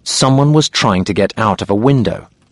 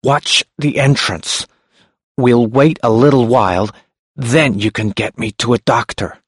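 speaking with difficulty; 3 semitones higher than usual. Acted. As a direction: push each word out with effort. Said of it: "He spoke with difficulty".

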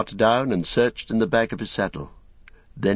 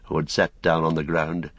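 ordinary delivery, nothing marked

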